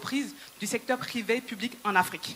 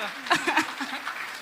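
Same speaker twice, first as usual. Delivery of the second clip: laugh; 4 semitones higher, 3 dB quieter than usual. Real, not acted. Laughs after calling the mayor.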